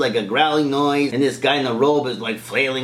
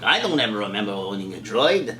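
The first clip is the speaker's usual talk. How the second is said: put-on forgetful old man; 5 semitones lower, 4 dB quieter than usual. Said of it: "I'm getting senile in my old age".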